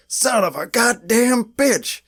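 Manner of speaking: slow, high-pitched mock cursing; angry outburst. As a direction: draw out each word like an overacted movie curse. Imitating Earl's angry reactions.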